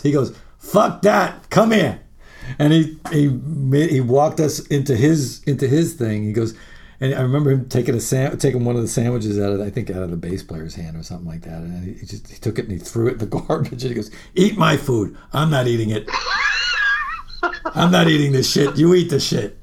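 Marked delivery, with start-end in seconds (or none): 0.6-2.0 loud, higher mock order; imitating Leslie's loud, bossy call; brash command. Leslie ordering band over. 14.3-19.6 gruff insistence. Retold or imitated. Leslie giving away his food.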